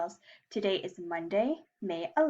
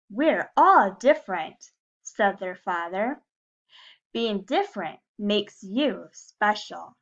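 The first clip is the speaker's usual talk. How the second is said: lively, louder storybook reading; reading to children; 2 semitones higher, 6 dB louder, slower than usual. A narrator's reading. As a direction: read it like story time for little kids. Reading a picture book to class.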